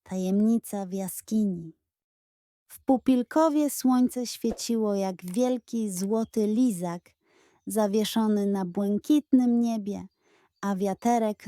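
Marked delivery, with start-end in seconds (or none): none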